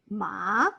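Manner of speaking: "ma" falling then rising; third tone, dipping. Shown as a demonstration. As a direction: dip "ma" down and bring it back up. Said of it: "go down and go up again".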